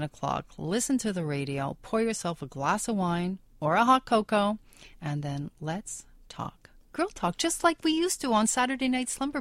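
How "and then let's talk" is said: whisper. Real, not acted. Intimate invitation to listeners.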